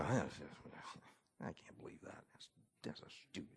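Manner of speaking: very low, whispery grumble; grumbling under breath. Shown as a demonstration. Preacher showing what murmurings are, grumbled under breath. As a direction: mutter it to yourself, barely audible. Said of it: "low grumbling under our breath".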